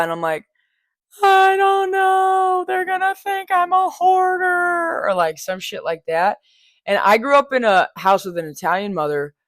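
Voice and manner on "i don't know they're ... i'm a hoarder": high, very slow drawn-out whine; whiny worried self. Retold or imitated. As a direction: stretch it out like a worried child. Anxious reply to husband, retold.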